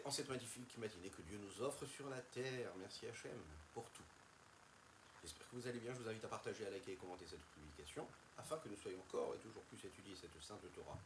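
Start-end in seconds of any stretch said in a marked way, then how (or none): none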